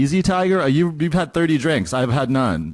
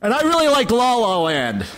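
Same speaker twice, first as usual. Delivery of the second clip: mocking imitation; 7 semitones higher than usual. Mimicking the ex.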